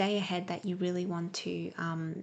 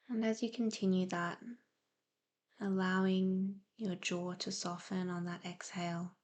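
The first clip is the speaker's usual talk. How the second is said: guided meditation; 4 dB quieter than usual. Real, not acted. Host easing listeners into breathing and present awareness, soft meditative delivery. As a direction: gently guide them into calm, leaving space to breathe.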